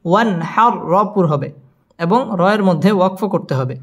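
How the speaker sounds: usual speaking voice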